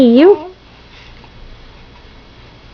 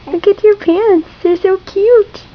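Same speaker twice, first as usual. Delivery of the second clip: higher, slow cooing praise; 6 semitones higher than usual. Real, not acted. Mother praising her baby's outfit, cooing high.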